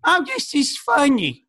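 put-on high, slow fussy older lady voice; mom's friends. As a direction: play a doting older lady reading online, slowly and high. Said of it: "they'll be like".

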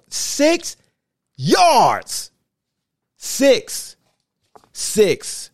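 can't believe the number; incredulous. Real, not acted. Repeating outraged at stat.

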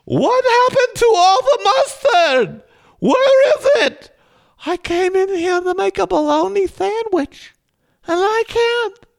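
shrill cartoon mom squawking about missing mustard; over-the-top shrieking mom; mom impression. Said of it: "that's not how she would say it".

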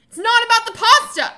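loud, high-pitched outburst; quoted outburst. Acted. Host performs a show quote for guessing, loud outburst. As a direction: belt it out as an indignant quote.

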